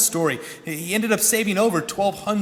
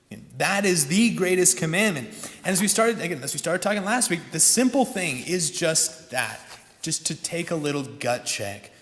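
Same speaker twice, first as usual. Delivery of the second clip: usual speaking voice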